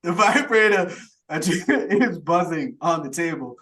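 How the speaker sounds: high, loud, struggling through laughter; laughing through words. Real, not acted. Host retelling a silly film scene, laughing through it. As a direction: push the line out slowly while laughing.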